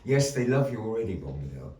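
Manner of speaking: normal talking voice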